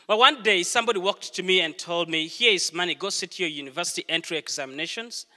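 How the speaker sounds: ordinary delivery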